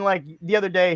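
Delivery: normal talking voice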